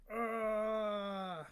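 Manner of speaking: a mock groan as the stick lands; pained grunt. Describing being hit, a mock pained ugh.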